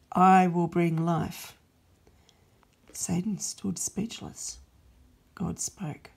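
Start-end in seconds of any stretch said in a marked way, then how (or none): 0.1-1.5 louder, firm resolve, each I will stressed; God's loud proclamation. Acted. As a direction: announce the plan like a decree. God replies.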